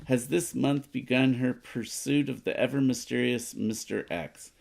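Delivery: ordinary talk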